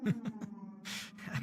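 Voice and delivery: laughing. Real, not acted. Laughing at own voice.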